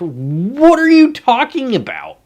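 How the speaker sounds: high, drawn-out exasperated cry; exasperated disbelief. Real, not acted. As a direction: stretch it out in utter disbelief. Exasperated at nonsense instructions.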